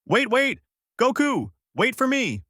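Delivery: very high-pitched urgent call; shouting out. A narrator's reading. Bulma chasing Goku on her motorcycle, high shouted call. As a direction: call after someone who is leaving you behind. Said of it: "shouting out".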